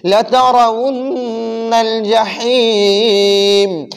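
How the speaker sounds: brisk, flowing Quran recitation at hadr pace. Shown as a demonstration. Teacher recites the whole surah in fast hadr style. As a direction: recite quickly and smoothly. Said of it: "हदर पढ़ते हैं।".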